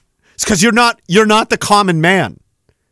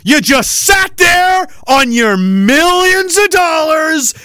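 slow, higher yelled accusation; angry shout; 9 semitones higher, slower than usual. Real, not acted. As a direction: hammer each word out in fury. Ranting at politician.

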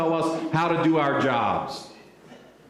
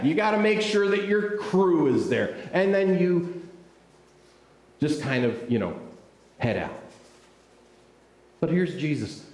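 ordinary talk

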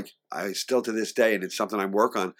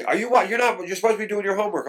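scolding mother; 11 semitones higher, 4 dB louder, faster than usual. Retold or imitated. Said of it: "my mother'd say".

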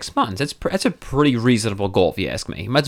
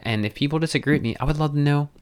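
ordinary delivery

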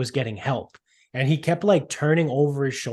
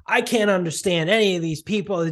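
higher, sneering mock complaint; 6 semitones higher than usual. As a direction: parody the old guy's whining, higher.

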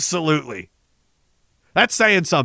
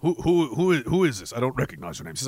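ordinary delivery

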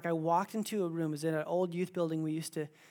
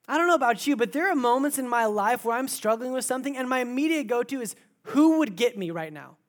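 preaching at full force; 6 semitones higher, 8 dB louder than usual. Acted. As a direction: proclaim it to the whole room, building.

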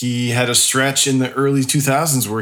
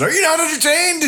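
lightly voiced movie gladiator, high. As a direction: play it like a mock arena hero. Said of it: "Rob stood there with a plate of wings and said".

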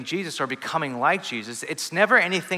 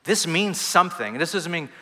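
normal talking voice

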